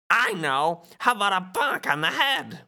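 high cartoon duck voice; Donald. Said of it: "Donald says".

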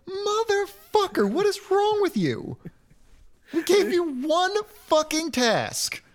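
high, slow mock outrage; exasperated parents. Parents scolding their wizard son, shrilly exasperated. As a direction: stretch each word in high-pitched disbelief.

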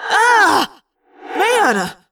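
high, loud startled cry; pained cry. Acted. Scene: Jake knocked around by a giant raindrop, alarmed cry. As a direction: shout out as you're knocked spinning.

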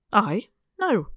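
high, loud startled protest; startled refusal. Acted. Aunt startled at being asked to play ghost. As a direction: jump at the idea in alarm.

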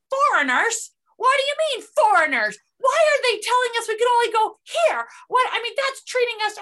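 put-on high, loud, indignant Southern accent; mock Southern accent for a loud, complaining tourist. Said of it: "I'm giving her a Southern accent".

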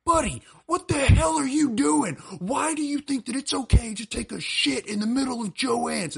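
high, strained yelling as an angry father; outraged dad. Retold or imitated. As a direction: imitate a dad losing it at his kid. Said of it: "he goes".

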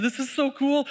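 high-pitched, delighted exclamation; excited judge. Retold or imitated. Judge thrilled after making the family official, excited. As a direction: gush about it, voice lifted high. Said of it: "he's so excited".

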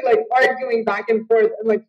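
ordinary delivery

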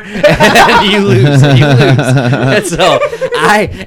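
loud laughter running through the words; laughing. Real, not acted. Telling friends the cop story, loud laughing. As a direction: laugh the words out, can barely hold it.

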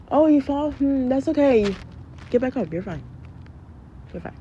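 brisk tough-love parent. Acted. Parent reacting to fallen child.

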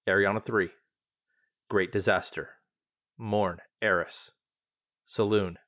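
measured, formal archive readout; log reading. A narrator's reading. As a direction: read it like a classified record. Reading a recorded log aloud.

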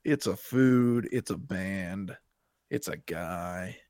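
slow, flat listing of answers; slow deadpan. Pretend. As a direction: drag out each short answer slowly.

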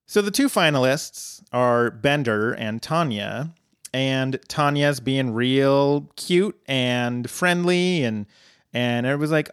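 normal talking voice

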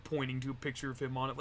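normal talking voice

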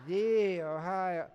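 very slow, loud, stretched "The"; obnoxious boast, mocked. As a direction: parody the cocky alum, dragging out "The". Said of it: "that's how obnoxious they are".

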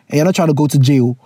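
ordinary talk, nothing marked

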